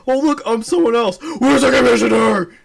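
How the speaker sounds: hammed-up high hero voice; mock Superman voice. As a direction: play a dim hero looking around. Superman parody voice.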